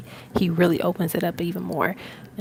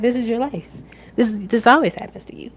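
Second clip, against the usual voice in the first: higher, mildly exasperated retort; imitating best friend; 5 semitones higher, 5 dB louder than usual. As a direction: tease a friend about their luck. Voices her amused best friend.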